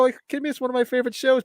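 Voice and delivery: high, eager answer; excited reply. Retold or imitated. Telling the customers it's his favorite show, eager high voice. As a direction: gush about a favorite show. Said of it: "I go".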